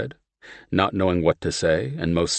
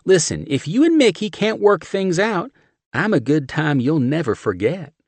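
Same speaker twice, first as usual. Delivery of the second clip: put-on high, loud come-on in Southern accent; flirty Southern; 11 semitones higher, 4 dB louder than usual. A narrator's reading. Steph propositioning John, Southern accent. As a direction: offer yourself with a wink.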